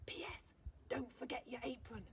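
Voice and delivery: whispered aside, voiceless; hushed whisper to the reader. A narrator's reading. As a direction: breathe the PS like a secret. Whispered postscript in read letter.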